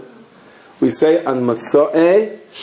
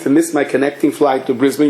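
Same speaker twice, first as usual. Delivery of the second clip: ordinary talk, nothing marked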